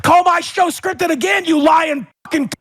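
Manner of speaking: furious shouted dare; crashing out. Real, not acted. Host dares his accuser to repeat it, crashing out. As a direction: yell the insult in a real rage. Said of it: "Boogie would keep crashing out".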